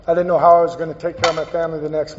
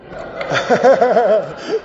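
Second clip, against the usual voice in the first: teary laugh; 4 semitones higher than usual. Real, not acted. Emotional laugh mid testimony.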